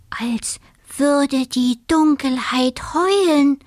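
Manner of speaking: high, slow, stretched-out words; spooked. A narrator's reading. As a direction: draw it out eerily like a howl. Frightened dormouse likens darkness to howling.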